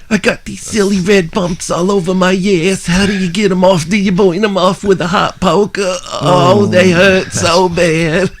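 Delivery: high, whiny nebbish complaint; put-on kvetching whine, high throughout; Mort from Family Guy.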